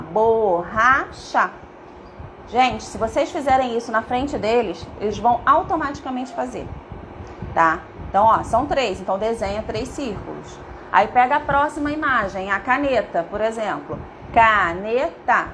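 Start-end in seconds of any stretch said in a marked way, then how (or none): 0.0-1.5 very slow, drawn-out bo-rra-cha; syllable by syllable. Shown as a demonstration. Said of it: "quantas vezes a gente abre a boquinha pra falar?". 14.3-15.5 slow ca-ne-ta in three separate beats; syllable by syllable. Shown as a demonstration. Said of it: "tem três".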